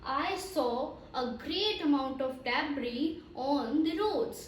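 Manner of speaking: slow model sentence with "debris" said carefully. Shown as a demonstration. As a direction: read "debris" slowly and clearly, as a teacher. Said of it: "So you have to say that". (धीमे, साफ़ उच्चारण वाला उदाहरण वाक्य)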